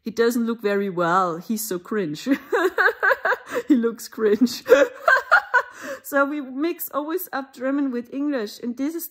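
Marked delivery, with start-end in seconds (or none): 2.3-3.6 real high laugh burst. Real, not acted. Laughing at own impression. 4.7-6.0 real loud high laughter. Real, not acted. Laughing at own impression.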